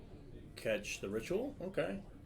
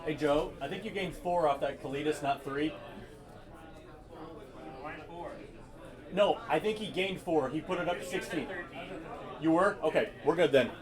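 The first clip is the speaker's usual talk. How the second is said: raised, higher voice to reach another player; calling out; 5 semitones higher than usual. Real, not acted. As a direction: call over to check the score. Calls out to Joe across the shop.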